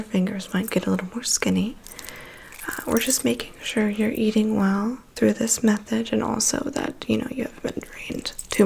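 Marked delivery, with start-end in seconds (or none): none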